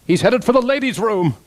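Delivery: comic announcement, pitched well up; performed quip. Acted. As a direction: call it out high and animated. Voice actor performing a dubbed line.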